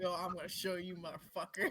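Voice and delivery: very soft, under the breath; hushed competitive vow; muttered challenge. Acted. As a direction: mutter a private threat to win.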